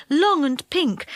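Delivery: ordinary delivery, nothing marked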